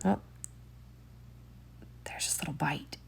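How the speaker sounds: plain reading voice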